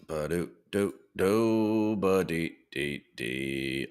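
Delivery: slow absent-minded scatting; idle scat hum. Real, not acted. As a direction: scat softly while busy. Humming while setting up screen.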